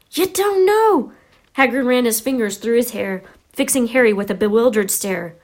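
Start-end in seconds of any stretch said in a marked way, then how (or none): 0.1-1.1 high, slow astonishment; drawn-out amazed outburst; incredulous. A narrator's reading. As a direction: repeat it in rising disbelief. Hagrid astonished at Harry's ignorance.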